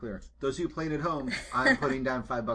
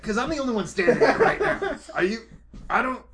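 laughing mock question; 6 semitones higher, 3 dB louder, faster than usual. Player mocks NPC mumbling mouth.

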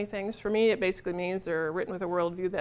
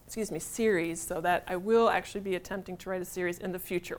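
ordinary delivery

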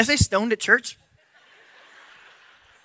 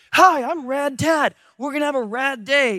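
over-excited camp guide; 6 semitones higher than usual. Retold or imitated. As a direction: pump it up like an overeager instructor. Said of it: "He's like".

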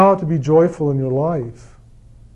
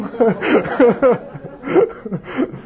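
laugh; 6 semitones higher than usual. Real, not acted. Laughing at own joke.

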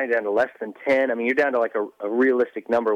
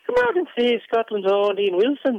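high, hammed-up Scottish ad voice; mock Scottish announcer; 10 semitones higher than usual. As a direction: sell it like a Scottish promo, with a put-on Scottish accent. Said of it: "trust me".

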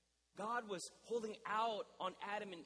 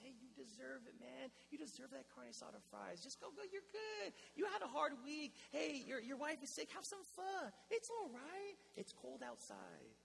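coaxing tempter voice; 7 semitones higher than usual. Retold or imitated. Pastor mimicking his inner voice talking him into food, high and wheedling. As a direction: sweet-talk yourself into a treat. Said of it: "a lie I love to tell myself".

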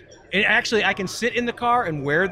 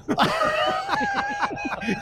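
high burst of laughter; 4 dB quieter than usual. Real, not acted. Laughing at the mogul joke.

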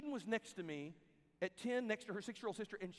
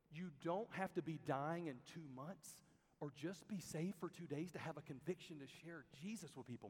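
ordinary talk, nothing marked

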